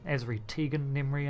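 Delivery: ordinary talk, nothing marked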